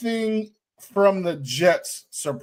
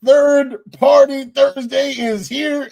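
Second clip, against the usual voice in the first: announcer voice; 6 semitones higher, 5 dB louder, slower than usual. Acted. Show intro announcement.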